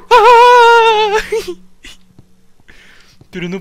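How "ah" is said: sudden high piercing shriek; startled scream at a jump scare; real scream of fright. Real, not acted. Jump scare in horror game.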